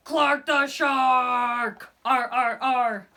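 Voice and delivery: loud, slow growling Clark the Shark; a loud playful shark growl; growly shark voice. A narrator's reading. Reader growls shark name.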